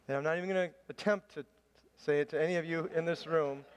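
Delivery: normal talking voice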